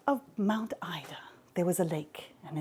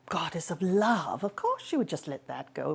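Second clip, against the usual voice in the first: plain reading voice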